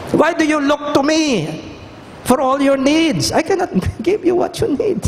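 high, earnest plea; pleading Moses. Retold or imitated. Preacher voicing Moses pleading.

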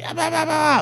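high-pitched mock outraged woman; hostile driver. Hostile driver griping at the cop who stopped her, mock high-pitched outrage. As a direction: rattle off a squealed, hammed-up complaint. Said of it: "immediately she's hostile".

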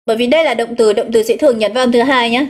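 usual speaking voice

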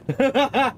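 quick high giggle; a short shaken laugh. Real, not acted. Player still shaken, short high laugh.

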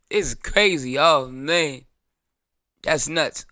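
ordinary talk

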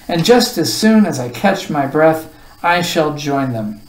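usual speaking voice

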